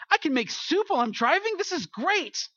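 high-pitched, hammed-up excitement; amazed delight. Traveler marveling at a truck stop crock pot, amazed. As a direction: gush in mock amazement, pitch jumping up.

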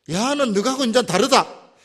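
loud, slow warning to the servants; a master warning servants in a loud, slow voice; stern warning. Retold or imitated. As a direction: lay down the law, slowly and loud.

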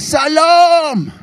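long, high, loud crowd roar; roared greeting. Retold or imitated. Three hundred schoolchildren roaring back to him, bellowed. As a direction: bellow it like hundreds of kids answering at once. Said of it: "som brølte".

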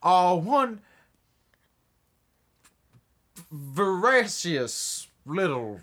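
slow, grand Southern drawl; southern gentleman villain. Retold or imitated. Villain taunting the hero in a slow Southern drawl. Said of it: "and he's like".

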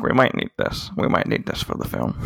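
low, breathy murmur, almost unvoiced; muttering it to himself; hushed George quote. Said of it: "George's like".

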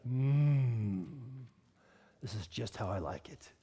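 low, hushed, contented murmur; long pleased hum, then quiet contentment; savouring satisfaction. Acted. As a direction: relish it softly, as if tasting a perfect bite. Acting a satisfied diner.

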